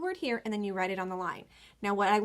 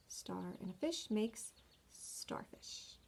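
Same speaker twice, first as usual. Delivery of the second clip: slow whispered recap; 11 dB quieter, slower than usual. Shown as a demonstration. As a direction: breathe the sentence gently to the children. Soft recap to children.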